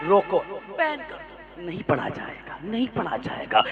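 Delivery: low, soft mocking of the objectors; mock opponents. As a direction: murmur the ban demand like a sneering opponent. Voicing opponents demanding ban. (धीमी, उपहास भरी आवाज़ में विरोधी)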